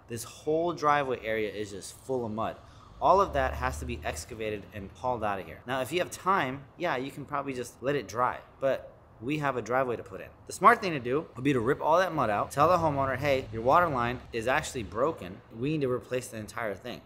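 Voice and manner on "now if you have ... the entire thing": usual speaking voice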